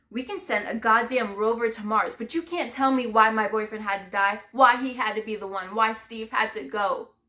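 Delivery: loud, anguished protest; angry grief. Acted. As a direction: let the rage at the loss pour out loudly. Rages at unexplained death of boyfriend.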